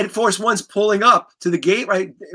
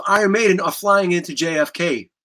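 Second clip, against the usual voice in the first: ordinary delivery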